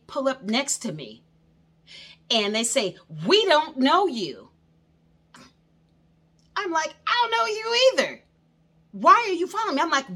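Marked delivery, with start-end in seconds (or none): 3.3-4.5 retold confrontation. Neighbor confronting driver, retold. 7.1-9.8 high, loud exasperated yell; mock-furious retort to the neighbors; indignant shout. Heated retort to stalkers, retold.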